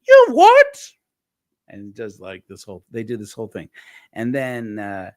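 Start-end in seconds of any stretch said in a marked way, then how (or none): 0.1-0.9 very high, very loud falsetto mother's yelp; very high, very loud falsetto outrage; mum's outraged shriek. Retold or imitated. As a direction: shriek in outraged disbelief like a mum in drag. Brian's mum indignant.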